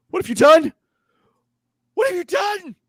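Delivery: high, loud cry of horror; horrified shout. Acted. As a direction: shriek it as a horrified witness. Game master voices horrified Henrietta.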